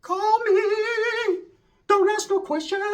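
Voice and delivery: high, sung-out lilting line; high sing-song imitation of the girlfriend; sing-song mock girlfriend. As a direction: sing it to him like a teasing girlfriend.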